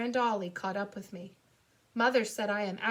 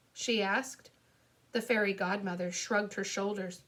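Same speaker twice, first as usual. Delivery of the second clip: ordinary delivery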